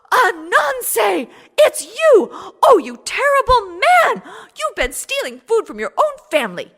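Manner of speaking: high, loud outraged wife crying out; shocked scolding cry. Acted. Eso scolds Ananse, shocked.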